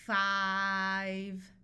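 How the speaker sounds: long F into a loud held vowel; drawn-out, loud phonics blending; sounded out. Shown as a demonstration. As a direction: stretch "Five" slowly through its sounds.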